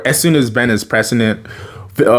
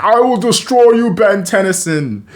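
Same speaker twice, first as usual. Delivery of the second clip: villain threat; 10 semitones higher than usual. Retold or imitated. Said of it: "he's like".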